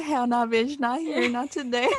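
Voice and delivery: loud, sassy mock telling-off. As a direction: put a pretend boyfriend in his place. Imagined telling off her boyfriend.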